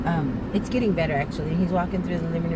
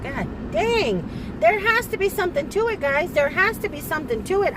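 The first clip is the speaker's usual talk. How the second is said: exasperated outcry; 8 semitones higher than usual. Real, not acted. Venting frustration to viewers.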